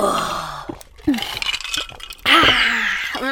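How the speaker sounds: long contented "aah" after a gulp; satisfied sigh after drinking. Real, not acted. Co-host savours the first gulp after a toast, satisfied sigh.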